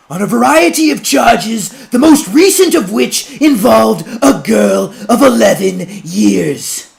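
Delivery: high, loud orator's denunciation; judge's public denunciation. Acted. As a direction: thunder the accusation to the crowd.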